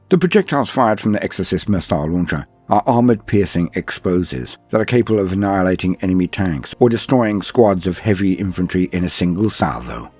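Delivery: normal talking voice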